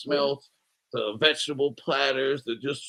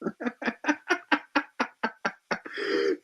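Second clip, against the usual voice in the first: breathless, airless laugh high in the throat; silent wheezing laugh; 12 semitones higher, 7 dB quieter than usual. Real, not acted. Laughing at a coworker's orgy story, wheezing silently. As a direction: laugh with no voice behind it.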